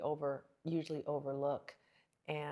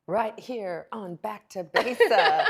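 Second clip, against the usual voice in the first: slow, louder, laughing through it; laughing tease; 2 semitones higher, 9 dB louder, slower than usual. Real, not acted. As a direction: drag it out with a laugh like a show teaser.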